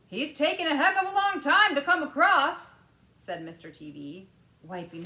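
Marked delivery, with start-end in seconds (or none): 0.1-2.8 higher, louder worried father; anxious father. A narrator's reading. Father wiping his brow, anxious and loud. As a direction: fret impatiently. Mr TV voiced.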